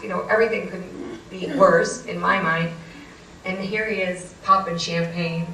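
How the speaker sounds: ordinary delivery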